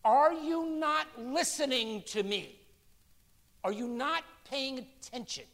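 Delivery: Jesus scolding a distracted disciple, drawn out and pained; exasperated Jesus. Jesus chiding a guest at the banquet table, exasperated and slow. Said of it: "And Jesus is like".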